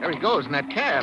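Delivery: urgent, chasing a cab; urgent excitement. Acted.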